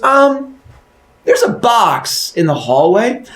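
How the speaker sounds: slow, high put-on voice; puzzled wife. Wife tells husband about the box, slow puzzled voice. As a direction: point it out slowly and high.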